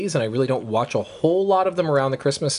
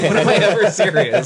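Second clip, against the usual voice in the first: tag joking protest; 8 semitones higher, 7 dB louder than usual. Real, not acted. Joking after admitting he is kidding.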